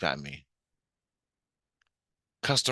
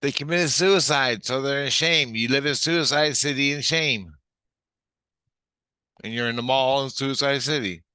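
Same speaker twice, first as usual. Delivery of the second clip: raised, emphatic explaining voice; Tina; 6 semitones higher, 5 dB louder than usual. Retold or imitated. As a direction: explain it loudly and insistently. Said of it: "Tina goes".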